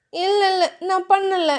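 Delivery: put-on flustered young wife; shy heroine. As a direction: brush him off shyly, voice lifted. Dhiya voiced higher.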